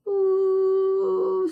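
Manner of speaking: very high, slow level ooh; flat drawn-out ooh. Shown as a demonstration. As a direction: hold one high flat note. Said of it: "straight across".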